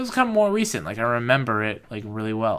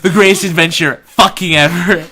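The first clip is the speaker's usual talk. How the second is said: shouted with a laugh, much higher; laughing loud proclamation; 8 semitones higher, 15 dB louder than usual. Shown as a demonstration. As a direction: belt the title out, laughing. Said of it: "I literally titled it".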